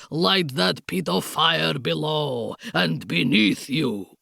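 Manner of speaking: put-on low, slow menacing Count with Bragislanian accent; thick Bragislanian accent. A narrator's reading. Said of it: "in his thick Bragislanian accent".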